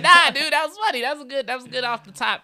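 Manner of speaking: high, loud at first, then fading; impressed guy. Retold or imitated. A comedian reassuring him backstage, high eager praise. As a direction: praise it, starting big. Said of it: "he was like".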